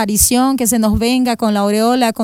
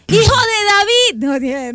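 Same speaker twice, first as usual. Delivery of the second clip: long, high, drawn-out cry; high pleading holler; mock shout; 9 semitones higher, much slower than usual. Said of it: "se puso a gritar".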